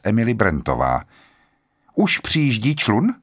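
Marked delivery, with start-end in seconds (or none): 1.9-3.1 curt question. A narrator's reading. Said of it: "zeptala se úsečně".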